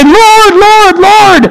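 high, sustained calls of LORD; crying out to God. Retold or imitated. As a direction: call out like the desperate at judgment. Quoting the many who cry out.

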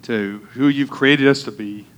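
hushed pastor's prayer. Acted. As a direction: pray it quietly and earnestly. Opening prayer before sermon.

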